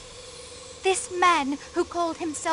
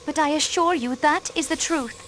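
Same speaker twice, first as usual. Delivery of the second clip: ordinary delivery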